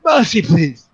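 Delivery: acted cry of a beaten thug begging to be spared; begging for mercy. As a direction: plead desperately for your life. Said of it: "goes down on the ground and said".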